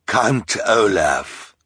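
slow, drawn-out proud name. A narrator's reading. Count Olaf proudly tells the reporter his name. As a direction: savour your own name, very slowly. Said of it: "said proudly".

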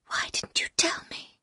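hushed, voiceless question; whisper. A narrator's reading. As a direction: whisper it to him, softly. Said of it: "I whispered".

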